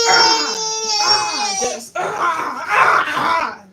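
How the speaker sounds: repeated short yells of "ah"; demonstrated outburst shouts. Shown as a demonstration. Overwhelmed mother showing how she could lash out, frustrated yells. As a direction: let out bursts of frustrated yelling.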